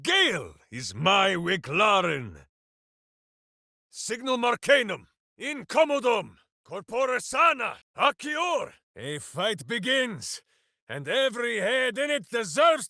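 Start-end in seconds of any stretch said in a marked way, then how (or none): 3.9-8.7 high chanted spell, ending in a shout on accior; spell incantation. Acted. Casting a spell aloud.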